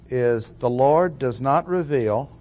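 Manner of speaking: ordinary delivery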